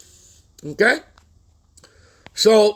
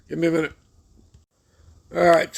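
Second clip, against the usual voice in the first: normal talking voice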